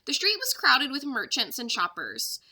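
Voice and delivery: usual speaking voice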